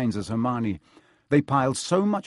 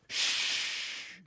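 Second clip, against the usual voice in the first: sharp whispered hush; shushing; 10 dB quieter than usual. Acted. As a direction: hush him urgently. Hagrid hushes again.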